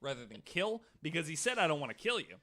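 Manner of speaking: usual speaking voice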